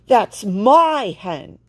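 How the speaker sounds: loud, slow furious claim; an enraged, slow shout; angry. A narrator's reading. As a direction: hammer each word out in anger. Fisherman yells chasing.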